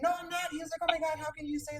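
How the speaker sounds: mock the coworker's offended squeal; offended outburst. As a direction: coworker flipping out, squealing high and fast. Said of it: "And he flipped out".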